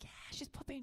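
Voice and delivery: unvoiced hushed agreement; whispered. Mom whispering agreement to her daughter in the bathroom. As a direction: hush it so the stall can't hear. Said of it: "I was like".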